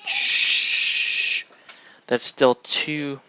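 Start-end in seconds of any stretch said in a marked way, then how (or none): 0.1-1.5 sharp loud shushing hiss. Real, not acted. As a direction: shush hard at the cat. Shushing the cat.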